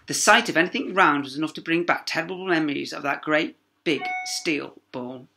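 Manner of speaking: plain reading voice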